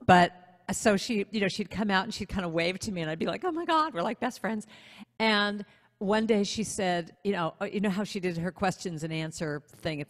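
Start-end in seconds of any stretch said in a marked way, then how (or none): 3.4-4.7 mock gushing in a high voice; giddy fangirl. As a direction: imitate a starstruck teen, pitched way up. Imagines being friends with her idol.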